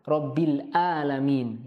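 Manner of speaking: "Rabbil alamin" slow, b held, clear throat ain; careful ain. Shown as a demonstration. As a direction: hold the b, press the ain of "alamin". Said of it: "huruf ain jangan tertukar dengan hamzah".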